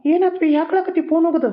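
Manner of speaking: high, alarmed rush of questions. Acted. Brother finds his sister crying after the phone call, alarmed and high. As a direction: ask in sudden worry why she cries.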